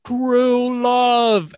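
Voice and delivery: drawn-out lisping "True love"; impressionistic priest. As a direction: impersonate the clergyman's odd "true love". Quoting the clergyman, Princess Bride.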